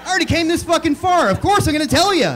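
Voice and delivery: high, loud mock indignation; emphatic insistence. Comic answering a doubting audience, loudly insistent. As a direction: raise your voice and insist, exasperated.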